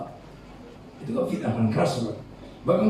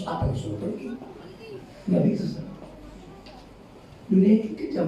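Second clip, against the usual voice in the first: ordinary talk, nothing marked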